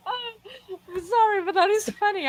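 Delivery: laughing so hard it sounds like crying. Real, not acted. Laughing at own joke.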